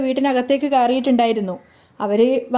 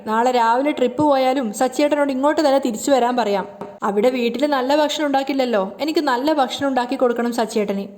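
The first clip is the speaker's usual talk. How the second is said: usual speaking voice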